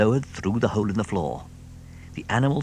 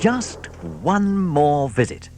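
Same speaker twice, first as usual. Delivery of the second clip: sly hint; 7 semitones higher, 3 dB louder, much slower than usual. A narrator's reading. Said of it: "cried Mister Fox".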